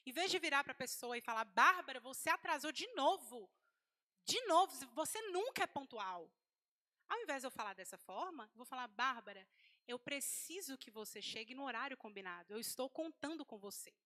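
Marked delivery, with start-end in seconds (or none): 1.4-6.3 loud attack on the name, then scolding; violent accusation. Shown as a demonstration. Telling off Bárbara for being late, attacking. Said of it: "de uma forma eu fui violenta". 8.9-13.9 non-violent request. Shown as a demonstration. Asking Bárbara to be punctual, calm and non-violent. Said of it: "De forma não violenta".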